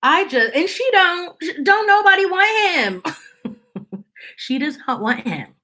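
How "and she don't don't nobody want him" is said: exasperated. Real, not acted. Exasperated, pitch climbs high.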